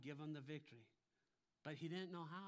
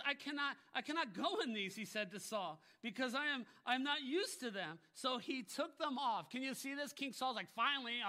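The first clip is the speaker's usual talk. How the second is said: loud, high storytelling from the pulpit; full-force preaching; 9 semitones higher, 11 dB louder than usual. Acted. Preacher telling Saul and David's armor story, full-force preaching. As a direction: retell it at a high, driving volume.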